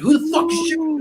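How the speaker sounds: high, alarmed cry of who's shooting; startled shout. Retold or imitated. Soldier startled by his own burst, alarmed. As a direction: jump out of your skin mid-sentence. Panicked retold quote during accident.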